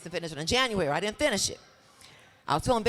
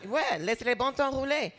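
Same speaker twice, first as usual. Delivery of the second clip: New Orleans French lilt, a bit higher; 4 semitones higher than usual. Acted. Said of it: "the lilt of New Orleans".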